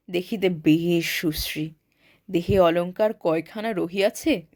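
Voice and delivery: ordinary delivery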